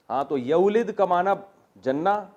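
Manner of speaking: usual speaking voice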